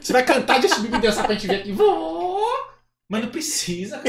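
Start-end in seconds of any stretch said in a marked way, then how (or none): 1.7-2.4 high, loud puppet cheer; eager squeaky dummy; Kleber. Acted. Puppet agreeing to sing and dance, high puppet voice. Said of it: "acha uma voz que pareça com o boneco".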